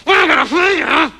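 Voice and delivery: mumbled through knife. Acted. Repeat mumbled.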